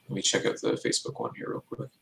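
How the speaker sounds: usual speaking voice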